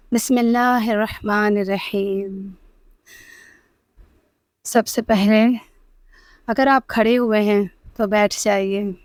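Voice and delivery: very soft, slow meditation voice with long pauses; hushed Islamic meditation, breath by breath; guided meditation. Acted. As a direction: guide them gently into calm, hushed and unhurried, drawing out the last words.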